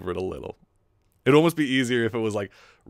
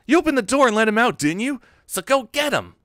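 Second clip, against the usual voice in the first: higher voice with a bit of edge; Misato; 13 semitones higher, 7 dB louder than usual. Acted. Woman blaming someone for the escaped penguin, edgy scolding. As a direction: scold loud and high, then order sharply. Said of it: "a bit of my higher voice".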